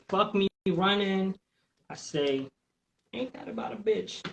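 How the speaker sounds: ordinary delivery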